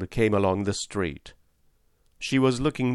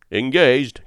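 loud, higher-pitched refusal; crying out; 5 semitones higher, 7 dB louder than usual. A narrator's reading. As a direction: call back firmly over the street. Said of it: "cried Jerry".

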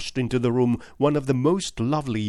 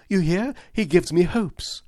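higher, louder, triumphant retort; defiant cry; 9 semitones higher, 4 dB louder than usual. Acted. Young woman taunting the inspector, a high defiant cry. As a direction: throw it at Lestrade in triumph. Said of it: "she cried, throwing back her head and looking defiantly".

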